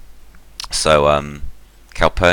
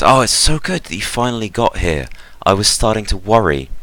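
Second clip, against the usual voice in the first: higher, gushing welcome; acted delighted reunion, pitched up; relieved greeting; 7 semitones higher than usual. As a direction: greet a long-awaited loved one with relief. Relieved sister greets with bear hug.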